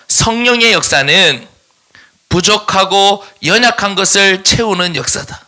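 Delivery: each word set out slowly to copy down; slow dictation to the congregation. Shown as a demonstration. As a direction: dictate it slowly for note-taking. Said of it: "동그라미 이 번 하고 쓰세요".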